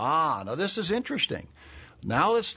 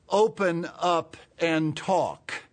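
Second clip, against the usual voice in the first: slow emphatic; 5 semitones higher, 3 dB louder, much slower than usual. Shown as a demonstration. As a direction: open up and talk, very slow, each word set apart. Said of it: "After this manner".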